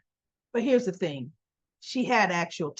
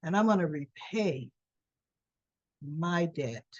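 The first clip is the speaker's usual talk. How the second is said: lower, trailing into soft mock menace; a little ominous; 4 semitones lower, 4 dB quieter, slower than usual. Cyrus promising Drew repayment, quietly ominous. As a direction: imitate a veiled threat, with long pauses. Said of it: "he's talking a little ominous to me".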